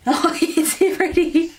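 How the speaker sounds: slow, high giggling coo. Real, not acted. Repeating to baby while laughing.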